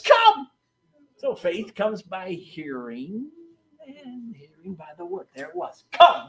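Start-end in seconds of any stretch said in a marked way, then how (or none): 0.0-0.5 calling come. Retold or imitated. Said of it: "he says". 5.9-6.3 one emphatic word; stress on come. Retold or imitated. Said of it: "one word".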